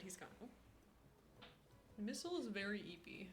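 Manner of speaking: hushed whisper about the sleepy cat. Real, not acted. Streamer whispering to chat about her dozing cat. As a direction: whisper so the cat stays asleep.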